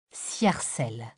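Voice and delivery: low, slow and soft; whispered low and slow, mysterious; slow mysterious word. A narrator's reading. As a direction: let the strange name hang. Narrator lingers on strange title.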